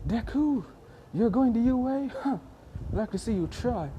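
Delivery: high-pitched, scornful challenge; angry. Acted. Bakugo challenging Deku about U A, angry and high-pitched. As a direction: sneer it as an angry rival. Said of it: "Bakugo getting angry by this".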